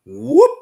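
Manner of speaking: slow drawn-out whoop demo; whoop call. Shown as a demonstration. Hunter showing the podcast host the call, a drawn-out whoop. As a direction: show the bigfoot whoop call. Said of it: "whoop, like that right there".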